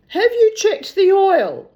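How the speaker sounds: high, loud, projected question; loud captain's query. Acted. Captain checks the car with his mechanic, calling out. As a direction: call it out to the mechanic.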